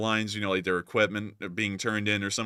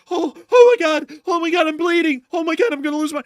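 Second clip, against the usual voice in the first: much higher, much louder mock panic; hammed-up panic; 9 dB louder than usual. Imitating a wounded North Korean soldier in hammed-up panic. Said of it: "he goes".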